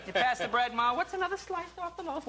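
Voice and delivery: high, sing-song mother's jab; mom hint voice. Retold or imitated. Mother hinting over dinner, in high sweet voice. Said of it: "those kind of mom hints".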